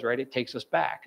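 normal talking voice

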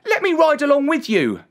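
put-on high, forceful boy's voice; volunteer boldly as a young lad; determined boy. A narrator's reading. Boy offers to confront the dragon, high determined voice.

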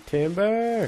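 high, stretched call of Timber; lumberjack yell. Pretend. Lumberjack warning the forest as the tree falls, hammed-up yell. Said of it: "And I'm yelling".

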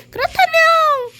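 very high loud cat squeal. Pretend. Voicing a cat villager.

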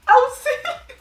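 a laughing shriek of help; laughing yell. Real, not acted. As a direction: yelp for help while laughing. Cake falling apart on delivery.

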